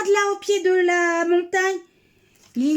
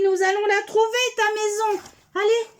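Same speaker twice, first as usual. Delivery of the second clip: ordinary delivery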